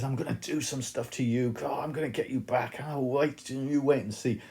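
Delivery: hammed-up impotent threats; empty threat. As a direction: bluster like someone powerless vowing payback. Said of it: "It's that horrible empty threat of somebody".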